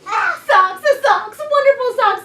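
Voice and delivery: high, loud, gleeful cheer; excited child. Acted. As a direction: cheer it like a happy kid. Tina repeats cheer.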